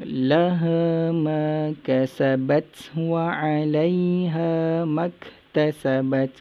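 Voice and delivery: melodic recited verse; lightly chanted recitation; Quran recitation. Shown as a demonstration. Teacher recites verse to class.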